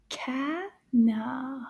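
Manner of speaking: CANARD slowly split into two syllables. Shown as a demonstration. Teacher modelling "canard" for children, split by syllable. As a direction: say "canard" in two separated beats.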